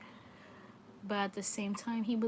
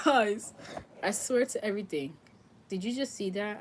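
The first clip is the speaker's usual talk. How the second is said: sudden loud gasp of alarm at the card; startled outburst; 3 semitones higher, 6 dB louder than usual. Real, not acted. Reader startled by the death card, loud surprised outburst. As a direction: blurt it out, caught off guard.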